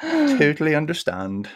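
slipping into a mock English accent; mimicked English accent. Host copies the guest's accent, mock English. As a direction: echo it in a posh English accent. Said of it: "picking up your accent".

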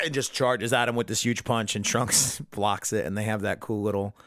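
ordinary talk

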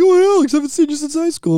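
put-on high, lively old-buddy hello; retold surprised greeting. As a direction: act out bumping into a school friend.

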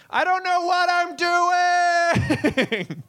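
very high, drawn-out wail; hammed-up high-pitched cry of helplessness; mock wail. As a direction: stretch it into a slow, high cry. Comic's exaggerated helpless outburst.

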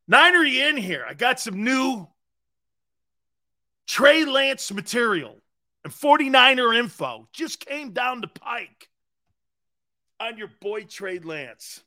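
loud, high call across the room. Real, not acted. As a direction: call out for someone nearby. Calling out to Niner again.

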